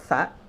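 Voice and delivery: "sa" as unvoiced th; careful dental "sa"; bitten-tongue S. Shown as a demonstration. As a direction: lisp "sa" with tongue between teeth. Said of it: "lidahnya digigit tapi bunyinya S".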